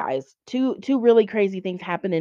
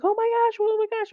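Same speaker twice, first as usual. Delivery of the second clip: imitating girls freaking out, much higher; faster than usual. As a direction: squeal it like panicked girls in the back seat. Girls panicking in the van.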